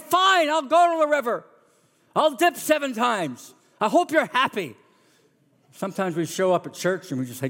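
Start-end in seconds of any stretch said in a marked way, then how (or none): 0.0-4.8 high, sulky resentment; grudging sulk. Retold or imitated. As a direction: give in with bad grace. Sulky Naaman grudgingly obeys.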